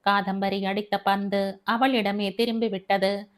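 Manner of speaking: ordinary delivery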